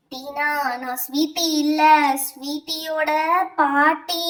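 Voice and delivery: eerie, drawn-out spirit announcement; grandmother's ghost. Acted. Grandmother's spirit revealing herself to the teacher, eerie ghost voice. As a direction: announce yourself as a possessing spirit. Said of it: "அவங்க பாட்டியோட பேய் பிடிச்சுக்கிறோம்".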